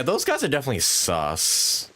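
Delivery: usual speaking voice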